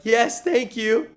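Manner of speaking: high, slow, laughing eager thanks; nervous eager reply. Retold or imitated. Teen answering the director's request, high and laughing. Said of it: "And everyone laughs".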